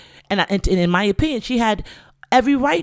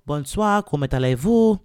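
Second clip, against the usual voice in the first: French accent, playful shout-out; French greeting; 4 dB louder than usual. Real, not acted. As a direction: greet them in proper French.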